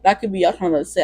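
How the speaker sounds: ordinary talk, nothing marked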